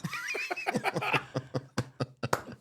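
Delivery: a big, high burst of laughter. Real, not acted. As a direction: let the laugh burst out and fall away. After punchline.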